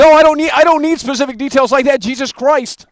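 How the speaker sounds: loud, high frustrated rant; exasperated yelling. Real, not acted. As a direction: lose patience, loudly. Exasperated with caller.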